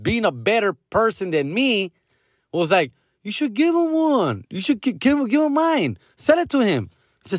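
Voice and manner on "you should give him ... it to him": high-pitched voice of the son; lighter, higher son impression; son's voice. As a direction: imitate your kid urging you, pitched up. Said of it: "But my son, Diego, my oldest".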